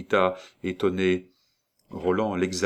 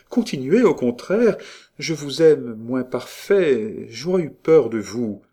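high and lively, settling down; princess urging him on; 5 semitones higher, 4 dB louder than usual. A narrator's reading. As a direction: urge eagerly, then draw out the confession. Princess eagerly urges him on.

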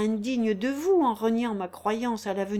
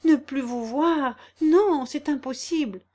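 higher, louder desperate denial; Émile protesting to Gilberte, rising and louder; anguished protest; 5 semitones higher, 4 dB louder than usual. A narrator's reading. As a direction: refuse it outright, voice rising. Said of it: "s'écria Émile".

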